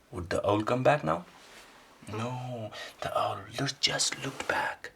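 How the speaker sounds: ordinary delivery, nothing marked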